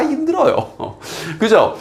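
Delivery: ordinary talk, nothing marked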